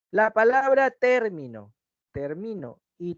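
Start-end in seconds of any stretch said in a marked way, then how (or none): 0.9-1.7 "término" with a strong, drawn-out TÉR; stress on first syllable. Shown as a demonstration. Teacher contrasting three words for students, "término" stressed on TÉR. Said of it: "se pronuncian con más intensidad". 2.1-2.8 "termino" said slowly as ter-MI-no; stress on middle syllable. Shown as a demonstration. Teacher contrasting three words for students, "termino" stressed on MI. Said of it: "su desplazamiento distingue el significado".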